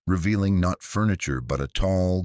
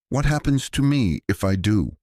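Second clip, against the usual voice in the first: frightened question; 6 semitones higher, 4 dB louder than usual. Acted. Sarah bargaining with the voices.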